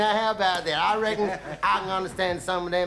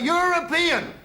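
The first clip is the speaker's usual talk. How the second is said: "European!" yelled much higher, at full stretch; 9 semitones higher, slower than usual. Retold or imitated. Calling to the next room, a high yell. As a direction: call it out high across the room like a tour request. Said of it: "I yelled out".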